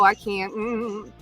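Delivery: ordinary talk, nothing marked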